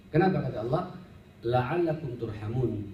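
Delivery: ordinary delivery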